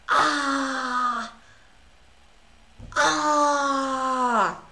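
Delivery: loud anguished shout of frustration; frustrated cry. Real, not acted. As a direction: cry out in real dismay. Losing chess game, frustrated cries.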